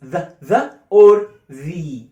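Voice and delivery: the said slowly as thuh, then loud as thee. Shown as a demonstration. Teacher contrasting the two ways to say the. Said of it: "makes the sound ز".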